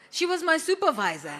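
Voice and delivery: giggling mock student voice; laughing quote. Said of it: "who's saying".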